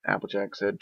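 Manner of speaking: usual speaking voice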